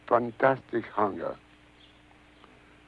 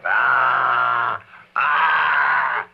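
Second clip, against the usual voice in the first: gagging ah; 6 dB louder than usual. Retold or imitated. Imitating the throat examination.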